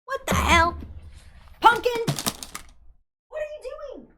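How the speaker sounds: startled call climbing very high; high-pitched scolding. Real, not acted. Streamer catching Pumpkin at something, high-pitched scolding. Calling out to Pumpkin, apparently a pet.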